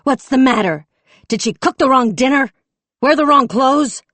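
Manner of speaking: high, loud sneering questions; mock him with rage; mocking fury. A narrator's reading. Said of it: "resumed her ire at the shooter".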